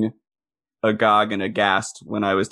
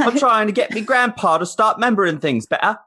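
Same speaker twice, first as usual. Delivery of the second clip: mock Cockney accent; 9 semitones higher than usual. Actress pitching her research in a high mock Cockney accent. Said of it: "a Cockney accent".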